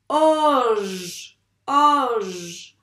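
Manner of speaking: oj loud, long O falling into held "zh"; blended syllable. Shown as a demonstration. Teacher showing the last syllable, oj loud and stretched. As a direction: boom out oj slowly, holding both sounds. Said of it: "on fait le".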